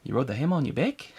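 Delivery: puzzled creature's question in a put-on Antipodean accent; Korg. Host repeats the favorite film line for laughs, accented impression. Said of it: "Taika Waititi doing that voice".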